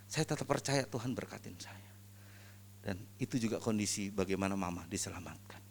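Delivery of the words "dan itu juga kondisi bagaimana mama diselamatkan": hushed, almost breathless; near whisper. Real, not acted. Sharing his mother's salvation, barely whispered. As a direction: let it drop to a whisper.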